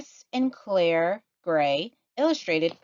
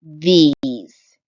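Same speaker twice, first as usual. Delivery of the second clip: one loud word; triumphant reveal; 11 dB louder than usual. Acted. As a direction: land the punchline proudly. Frog's punchline reveal.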